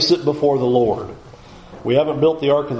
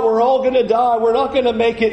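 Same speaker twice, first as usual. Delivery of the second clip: high, mock-panicked wail; panicked cry; 9 semitones higher than usual. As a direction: whine like doomed people at the sea. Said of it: "they cry out".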